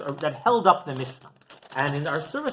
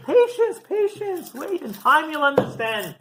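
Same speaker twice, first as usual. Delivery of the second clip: patient soothing; 10 semitones higher, 4 dB louder, slower than usual. Retold or imitated. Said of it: "he says".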